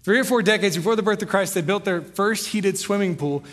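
fast, casual repeat of the same line; quick retelling. Shown as a demonstration. Pastor restating the quote for the congregation in a quick, casual way. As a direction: rattle it off, quick and conversational. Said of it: "I don't know how they did it".

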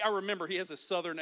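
ordinary talk, nothing marked

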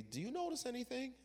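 high, quick, teasing question; a knowing nudge; the Lord asking. Acted. The Lord quizzes the preacher, high and quick. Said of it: "the Lord said to me".

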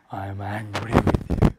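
mock-calm, very low and slow; softly drawn-out, deep imitation of calm fury; calm anger. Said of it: "You can do it just as calmly".

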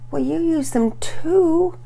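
put-on slow, high child's protest; weak answer. A narrator's reading. Sister defending herself to her brother, weakly. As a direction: draw out a feeble, high-pitched comeback. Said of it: "Penelope weakly answered".

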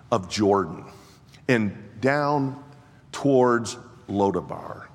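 slow, drawn-out preaching. Acted.

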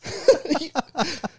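squealing laugh, high; real laugh at a joke; high burst of laughter. Real, not acted. Reacting to dad's joke.

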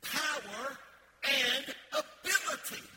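loud, high, slow preacher's call; leading a congregational repeat. Shown as a demonstration. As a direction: belt it out word by word for all to repeat. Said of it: "Say that with me".

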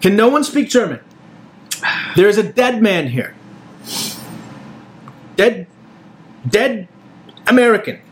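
German worker shouting about a dead GI; exasperated German. Acted. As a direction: plead desperately that nobody listens. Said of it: "The German man is saying".

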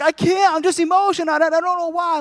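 high, loud weepy wail; mock crying. Wife answering her husband, mock tearful protest. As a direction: imitate an overwrought wife in tears. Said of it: "She was crying".

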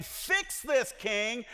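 high-pitched imitation of Esther's appeal; Esther demanding. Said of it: "Esther's saying".

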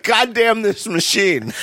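slow, fed-up grumble at the machine; exasperated anchor cursing. Retold or imitated. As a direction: drag out the curse like a frustrated TV anchor.

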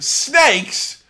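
hammed-up disgust at snakes; mock Indy freaking out; exasperated Indy. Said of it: "And Indy's like".

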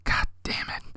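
whispered under the breath, very soft; soft mutter. Retold or imitated. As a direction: let it slip out in a hushed whisper. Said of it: "a soft muttered".

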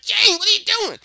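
high, loud panicked cry; freaked out. Retold or imitated. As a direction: yell it in alarm at what she's doing. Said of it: "Jack's freaked out".

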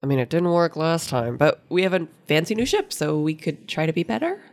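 ordinary talk, nothing marked

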